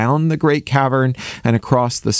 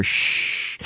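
soft hush; quiet shush into the ear; 7 dB quieter than usual. A narrator's reading. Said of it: "Alan said in his ear".

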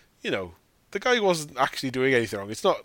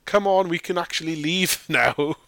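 children begging mum, voiced higher; higher kids pleading; 4 semitones higher than usual. Kids begging their mum to run away, higher pleading. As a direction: plead like a kid wanting out.

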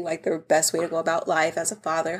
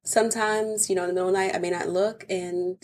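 ordinary talk

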